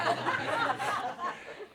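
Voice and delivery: airy giggle; breathy high laugh. Real, not acted. Narrator laughs.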